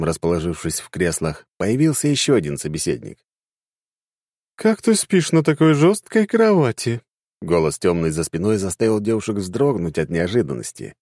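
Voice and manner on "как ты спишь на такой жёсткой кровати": high, amused intruder; playful dark goddess. Acted. Goddess appears.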